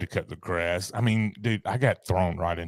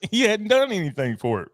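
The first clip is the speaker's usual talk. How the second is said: laughing, much higher; 11 semitones higher than usual. Real, not acted. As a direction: laugh through the line. Laughing repeat of joke.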